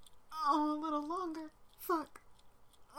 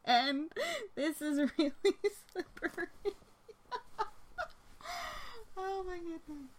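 laughing; 7 semitones higher, 3 dB louder than usual. Real, not acted. Laughing while slipping.

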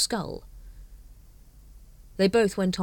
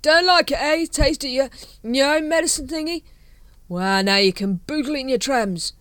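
higher, louder slurred jeering; drunken taunting; 8 semitones higher, 7 dB louder than usual. Acted. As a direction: taunt the shadows, tipsy.

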